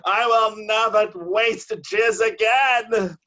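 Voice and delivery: slow, high and loud oath; dramatic vow. Retold or imitated. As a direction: swear it like a movie heroine. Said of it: "as God is my witness".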